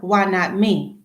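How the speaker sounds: ordinary delivery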